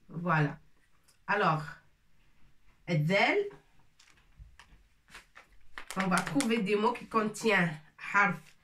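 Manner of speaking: ordinary talk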